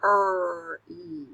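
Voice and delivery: Er-e split into separate sounds; sounding out words. Shown as a demonstration. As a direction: sound out each piece. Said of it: "Say them".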